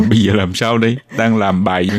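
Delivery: stuffed-up nasal voice from a cold; blocked nose. Real, not acted. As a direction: talk through a blocked nose. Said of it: "Nghẹt mũi rồi sao? Có giọng mũi".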